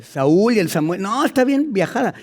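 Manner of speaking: normal talking voice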